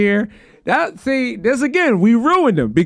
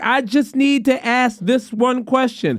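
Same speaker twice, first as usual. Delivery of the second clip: slow, higher, fed-up caller; exasperated insistence; 4 semitones higher, slower than usual. Retold or imitated. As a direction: spell it out slowly in exasperation. Retold caller pleading with agent.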